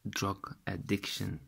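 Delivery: ordinary talk, nothing marked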